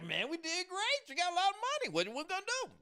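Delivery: very high, hyped-up retold brag; excited boasting. Woman reports a great year's money, high and excited. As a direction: play up the excitement, pitch way up.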